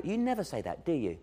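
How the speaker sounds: normal talking voice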